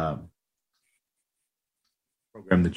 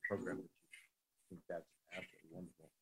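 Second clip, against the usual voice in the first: breaking up on a bad phone line; buzzy glitchy call audio. Real, not acted. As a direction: audio dropping in and out. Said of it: "You're, you're breaking up".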